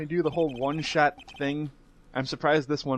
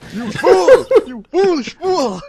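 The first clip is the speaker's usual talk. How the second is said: high, loud theatrical scolding; over-the-top, drawn-out yell of contempt; mock outraged shout; 14 semitones higher, 11 dB louder, slower than usual. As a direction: shriek it like a hammed-up villain. Mock outburst joining villain impression.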